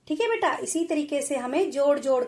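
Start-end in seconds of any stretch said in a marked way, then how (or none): none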